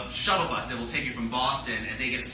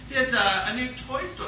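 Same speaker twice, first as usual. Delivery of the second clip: mom playing it casual, pitched up; imitating an offhand phone mention; fake casual; 9 semitones higher than usual. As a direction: toss it off lightly, higher and softening. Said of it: "Like, she's so casual".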